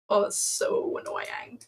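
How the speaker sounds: ordinary delivery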